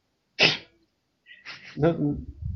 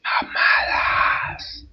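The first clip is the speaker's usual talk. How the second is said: long, hoarse-whispered mock shout; drawn-out heckle; much slower than usual. Co-host cutting into the comic-book explanation, drawn-out heckle. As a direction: stretch it out like a jeering cry.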